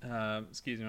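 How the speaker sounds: ordinary delivery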